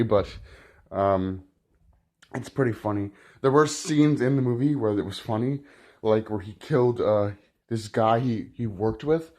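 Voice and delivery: ordinary delivery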